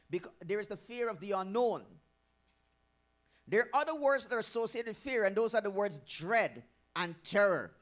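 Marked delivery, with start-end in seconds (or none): none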